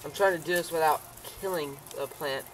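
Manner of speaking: ordinary talk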